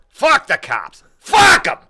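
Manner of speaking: loud, high Walken-style outburst; Christopher Walken impression. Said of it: "they just go to Christopher Walken. He goes".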